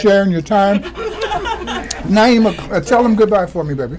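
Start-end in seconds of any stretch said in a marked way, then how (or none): 0.9-2.0 high bright burst of laughter; laughing. Real, not acted. Baby turning red. 2.1-4.0 laughing. Real, not acted. Talking to the baby while laughing.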